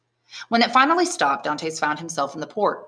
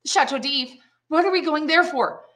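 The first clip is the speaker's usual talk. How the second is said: higher, frightened questions; alarmed; 11 semitones higher than usual. Acted. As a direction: voice rising in dread. Prisoner sees the dreaded prison.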